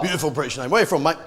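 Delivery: ordinary talk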